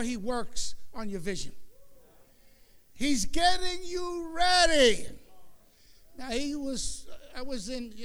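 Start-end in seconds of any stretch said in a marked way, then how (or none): 2.9-5.1 higher, slow, drawn-out; slow emphatic. Acted. Preacher stressing God's preparation. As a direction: stretch every word out.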